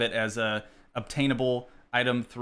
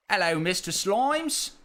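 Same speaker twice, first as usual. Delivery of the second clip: higher, broad mock British greeting; exaggerated put-on British hello; British accent; 5 semitones higher than usual. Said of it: "say in a British accent".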